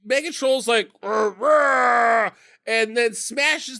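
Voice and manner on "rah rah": loud imitated monster roar; two loud roars, the second drawn out; mock troll roar. Mega Troll before smashing the ground, loud mock roar.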